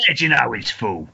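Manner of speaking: feed the line in stage Cockney; short hammed Cockney comeback; Cockney accent. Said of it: "let me do a Cockney accent".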